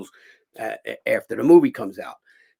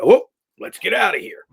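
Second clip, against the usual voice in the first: raised, higher and louder quoted call; rallying call; 8 semitones higher, 7 dB louder than usual. Retold or imitated. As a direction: call the crew out, louder and pitched up. Acts out fighters rushing in.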